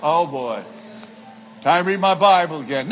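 low, unenthused grumble; mock reluctance. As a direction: act out dreading a chore. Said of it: "Are you like that when it's time to read your Bible?".

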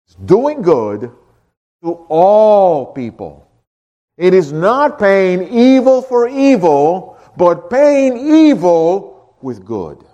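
slow, deliberate, weighing every word; drawn out like a solemn definition; slow emphatic preaching. Real, not acted.

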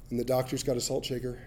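usual speaking voice

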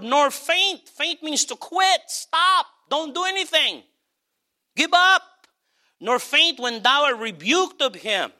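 fiery preaching. Acted. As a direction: preach it with fire.

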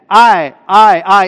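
"I" hammered out loud each time; emphatic. Shown as a demonstration. Preacher showing the emphatic Hebrew pronoun, emphatic. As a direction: punch each "I" hard in quick succession. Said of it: "It's emphatic".

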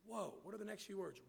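ordinary delivery

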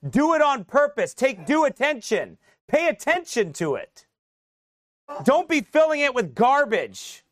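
loud, high, slow preaching that builds as it goes; full-force preaching. Acted. Pastor to congregation, urging them to keep their hearts, preaching at full force. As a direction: drive each phrase home to the congregation.

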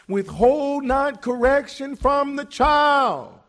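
slow, loud shouted verse; shouted scripture. Real, not acted. Preacher shouting a verse at the congregation, slow and loud. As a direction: thunder the verse out slowly.

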